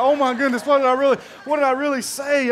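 mock horror. Imagined waitress realizing her gossip.

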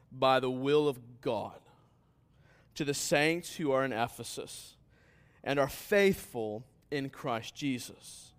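measured pulpit reading that builds; slow scripture reading. Real, not acted. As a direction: read the verse out slowly, building in force. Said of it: "read this slowly".

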